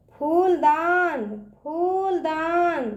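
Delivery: slow फूलदान, फू held long; drawn-out long ū. Shown as a demonstration. Teacher drilling children, फूलदान with long ū. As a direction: stretch out फू in फूलदान. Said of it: "इसका उच्चारण ना खींच के करते हैं".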